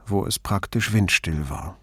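ordinary delivery

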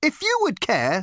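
high, strident, officious. A narrator's reading. Girl correcting Ford, strident. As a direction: cut across him stridently. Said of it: "said the girl with a strident voice".

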